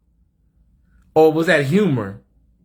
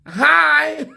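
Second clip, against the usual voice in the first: very high bright hi; 11 semitones higher than usual. Shown as a demonstration. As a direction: greet in your high natural voice. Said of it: "That's my voice".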